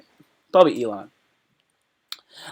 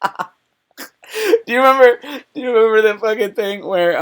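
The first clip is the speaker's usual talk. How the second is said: laughing; 6 semitones higher than usual. Real, not acted.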